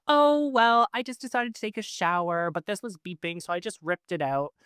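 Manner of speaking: breezy, high, casual shrug of a reply; nonchalant. Retold or imitated. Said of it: "I just said very nonchalantly".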